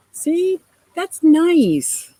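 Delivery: very slow and high, sweetly moved; tenderly pleased by the chat; touched. Real, not acted. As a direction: linger on it, moved. Touched by viewer comment.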